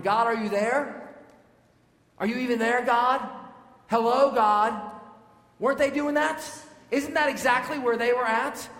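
loud, high, pleading complaint to God; angry prayer. Pretend. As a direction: cry out at God, demanding an answer. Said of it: "go down a path in which we're wondering".

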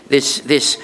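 usual speaking voice

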